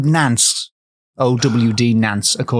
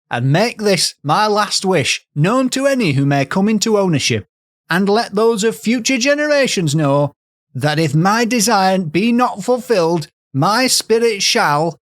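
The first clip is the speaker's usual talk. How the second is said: high, imploring mock-Yorkshire voice; theatrical Yorkshire plea; put-on Yorkshire accent; 7 semitones higher than usual. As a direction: plead like a dying Yorkshirewoman, pitched up. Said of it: "she's from Yorkshire".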